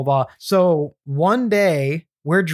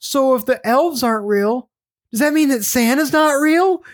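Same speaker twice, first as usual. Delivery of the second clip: put-on higher, rising childish alarm; dawning realization; 8 semitones higher than usual. Little sister realizing Santa isn't real, higher alarmed voice. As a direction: let the penny drop as the kid. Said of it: "she's like".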